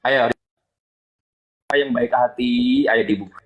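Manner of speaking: mock sweet coaxing. Real, not acted. Sweet-talking host mockingly.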